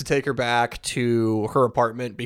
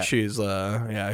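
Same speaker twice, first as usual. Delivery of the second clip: usual speaking voice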